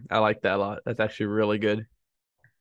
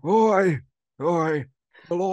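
loud, higher New York accent; put-on New York character voice, raised; Paul Grape; 7 semitones higher, 5 dB louder, much slower than usual. Shown as a demonstration. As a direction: belt out short shouts in a New York accent. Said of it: "a Paul Grape impression".

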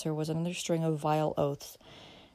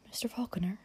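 hushed, half-whispered address; hesitant, quiet girl; timid; 3 semitones higher, 5 dB quieter than usual. A narrator's reading. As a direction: softly break the silence. Girl timidly breaks a long silence.

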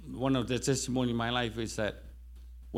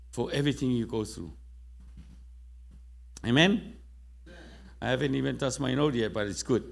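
preaching. Acted. Pastor urging his congregation, in a lifted preaching voice. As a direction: urge the church to believe.

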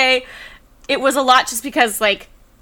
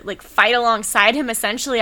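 ordinary delivery

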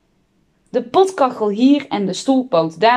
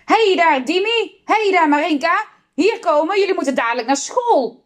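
high-pitched parents' summons; urgent, high calls, quickening into an order; calling out; 8 semitones higher than usual. A narrator's reading. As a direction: call the children back from afar. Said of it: "begonnen ze te roepen".

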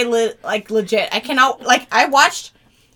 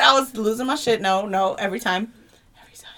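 ordinary talk, nothing marked

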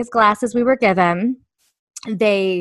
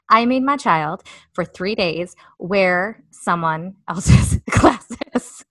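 ordinary talk, nothing marked

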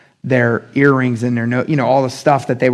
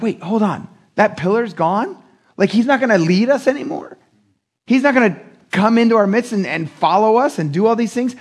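high, stunned disbelief, pitched up; shocked Israelites; 7 semitones higher than usual. Retold or imitated. People realizing God won't lead them, stunned and pitched up. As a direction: hold on, this can't be gone.

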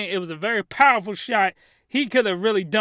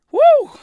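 loud, high excited whoo; high whoop; 11 dB louder than usual. Real, not acted. Reviewer cheering over the scene, high loud whoop. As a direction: let out a big whoop.

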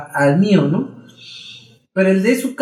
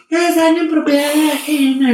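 imitating the shop lady; 10 semitones higher than usual. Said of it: "la señora me empieza a decir".

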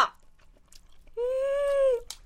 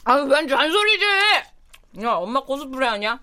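louder, higher irritated comeback; annoyed retort; 6 semitones higher, 7 dB louder than usual. Acted. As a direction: push back at the nagging.

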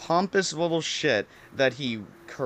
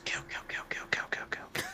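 hushed, unvoiced chant of the word; imitating a creepy whisper; 11 dB quieter than usual. Friend on the phone, whispering creepily. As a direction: breathe each word like a slasher's whisper. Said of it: "her friend goes".

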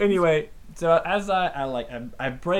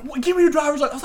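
fast, high imitated cop demand; police officer; 11 semitones higher, 3 dB louder, faster than usual. Officer asking the driver for papers, briskly imitated. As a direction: rattle off the order as the officer. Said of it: "he was like".

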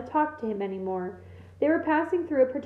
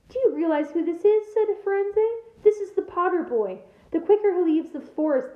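higher, louder insistent reply; urgent retort; 7 semitones higher, 4 dB louder than usual. A narrator's reading. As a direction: press the point hard. Firenze defends Harry to Bane.

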